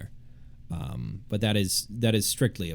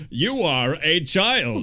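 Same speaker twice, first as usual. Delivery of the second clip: stern warning voice; 6 semitones higher, 4 dB louder, much slower than usual. Pretend. Mocking porn site voice.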